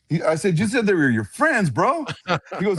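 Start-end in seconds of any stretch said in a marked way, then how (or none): 0.6-2.0 high-pitched retold disbelief; mock incredulity. Guest questions friend about strangers' house, mock incredulous. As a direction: challenge him, voice climbing higher and higher.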